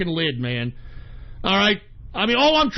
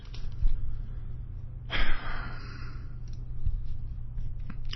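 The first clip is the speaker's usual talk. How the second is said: long, soft exhale; sigh, fed up with it; drawn-out tired breath; weary sigh; 7 dB quieter than usual. Real, not acted. Tired of it all.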